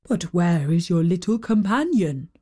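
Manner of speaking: lightly voiced low giant. A narrator's reading. Giant asking the children after their companion, lightly voiced.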